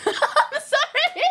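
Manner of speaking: giggling high through I'm sorry; laughing apology. Real, not acted. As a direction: apologize while cracking up. Laughing apology after slip.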